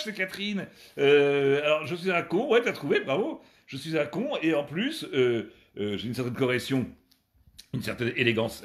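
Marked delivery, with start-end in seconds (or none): none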